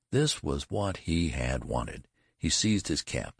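usual speaking voice

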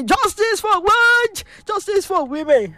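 rally leader calling out; call and response. Shown as a demonstration. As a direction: lead the chant high, drawn out. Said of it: "say it after me".

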